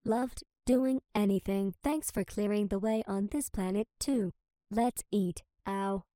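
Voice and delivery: ordinary talk, nothing marked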